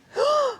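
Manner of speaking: sharp high gasp of delight; excited gasp. Real, not acted. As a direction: gasp at a sudden find. Spots mushrooms on the walk.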